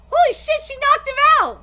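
high, loud astonished shout; shocked exclamation. Real, not acted. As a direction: blurt out in shock. Shocked reaction.

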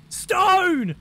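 high, drawn-out shout; really loud warning. Retold or imitated. Relaying teammate's warning to the driver, really loud. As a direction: yell it long and high as a warning. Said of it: "says Fast really loudly".

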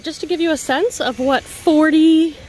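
ordinary talk, nothing marked

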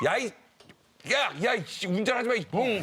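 imitated high, sputtering road rage; angry cursing. Retelling a driver cursing their car, angry shouting. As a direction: curse at the other driver in mock anger. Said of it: "화가 나니까".

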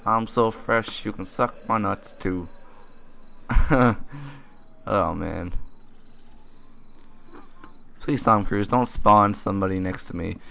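normal talking voice

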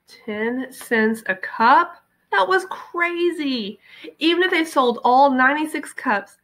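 slow, high disbelieving; disbelief. A narrator's reading. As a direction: stretch it out, can't believe it. Jessie shocked at the price.

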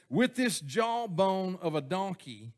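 slow, solemn scripture reading; slow solemn recitation. Acted. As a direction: read Samson's boast slowly and gravely. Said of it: "then he said".

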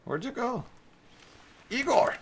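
high call across the map. Real, not acted. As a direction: shout the name high. Calling out to partner.

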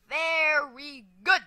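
slow, loud, stretched "very good"; emphatic praise. Real, not acted. Player praising own run, slow emphatic praise. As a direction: draw out "very", then punch "good" loudly.

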